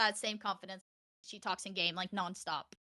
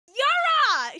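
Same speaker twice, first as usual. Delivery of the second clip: exasperated yell; 10 dB louder than usual. Retold or imitated. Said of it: "I'm like".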